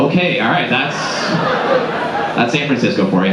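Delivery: ordinary delivery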